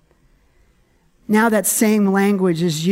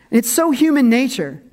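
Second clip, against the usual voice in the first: ordinary talk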